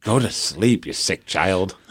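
a fed-up parent grumbling to himself; exasperated mutter. Retold or imitated. Tired parent muttering to child.

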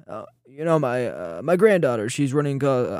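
faltering, badly faked politician impression; Mike DeWine. Host fumbling an imitation of the governor, faltering impression. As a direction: hem and haw through a mock excuse. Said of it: "the DeWine impression".

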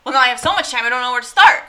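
high, loud mock overwhelm; overwhelmed exclamation. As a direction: throw up your hands at too much time. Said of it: "now I'm just like".

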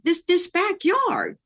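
high, slow stammer of amazement; drawn-out, marvelling answer; retold wonder. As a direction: stumble on the first word, awed and high.

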